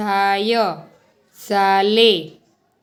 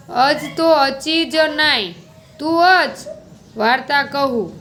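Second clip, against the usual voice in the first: Kutchi uncle; 3 semitones higher than usual. Acted. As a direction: answer the boy kindly in Kutchi. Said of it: "કરમસિંહભાઈએ ... કહ્યું". (કચ્છી બોલીમાં કાકાનો ઊંચો અવાજ)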